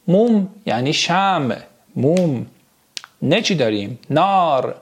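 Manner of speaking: normal talking voice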